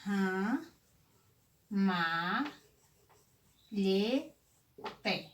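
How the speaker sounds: "Ram, ma, lhe, te" with pauses between; syllable by syllable. Shown as a demonstration. Teacher shows LH mid-word, syllable by syllable. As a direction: stretch and separate each syllable of ramalhete. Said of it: "Ó, como aqui".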